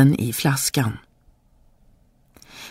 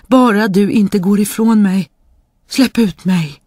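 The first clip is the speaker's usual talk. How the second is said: higher, louder begging call; pleading spirit; 6 semitones higher, 4 dB louder than usual. A narrator's reading. Trapped spirit begs the boy to stay, high pleading. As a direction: plead loudly not to be left behind.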